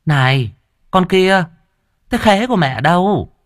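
put-on high-pitched stepmother calling the girl over. A narrator's reading. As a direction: demand it in a high, put-on woman's voice. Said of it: "Ả đi ra giả lả hỏi".

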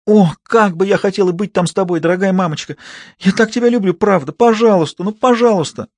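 a daughter begging her mother on the phone; squealing girl. Acted. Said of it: "та завизжала так пронзительно". (дочь визжит и умоляет маму в трубку)

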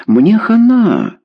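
put-on high, drawn-out teen wail; flustered dismay. A narrator's reading. Daughter realising she is caught by parents, dismayed. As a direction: exclaim it as if doomed. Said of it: "растерянно произнесла Лена".

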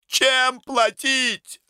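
slow, very high cry; impassioned outburst. A narrator's reading. Semyon lunges at the director's remark, high anguished cry. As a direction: hurl the question out in anguish. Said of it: "рванулся Семён".

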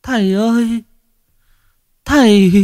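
high tearful wail calling the master; pouting, on the edge of tears; whimpering plea. A narrator's reading. Young woman wailing to the shaman, tearful and high. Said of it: "Cô này bỗng nhiên mếu máo".

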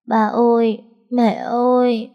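put-on high, slow little girl's whimpering call; a small child on the verge of tears calling out. Frightened little girl clinging to Tài's leg, whimpering for grandma and mom. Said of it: "Nó mếu máo gọi".

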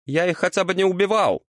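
high-pitched indignant protest; affronted devil defending himself. A narrator's reading. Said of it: "возмутился чёрт".

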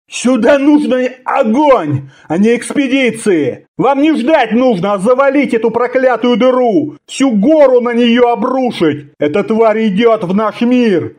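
high, agitated, insistent tirade; urgent warning. A narrator's reading. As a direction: desperately make them see the danger. Conan desperately warns his captors.

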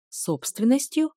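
высоко и медленно, с удивлением; медленно переспросить с недоверием; переспрашивает с удивлением. A narrator's reading. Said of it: "перебил её господин Джай". (удивлённо, с нажимом)

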